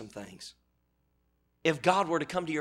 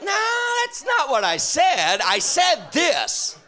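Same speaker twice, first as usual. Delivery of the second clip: loud, slow shouting God voice; God correcting; 11 dB louder, much slower than usual. Acted. God correcting a misquoting believer, slow loud booming. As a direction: boom out the correction. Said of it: "And God's like".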